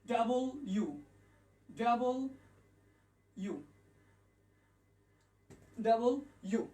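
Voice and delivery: "ডাবল ইউ" in two separated parts; correct letter W. Shown as a demonstration. Teacher drilling the letter for learners, correct pronunciation. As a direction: pause between "ডাবল" and "ইউ". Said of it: "যেটা দিয়েছিলাম".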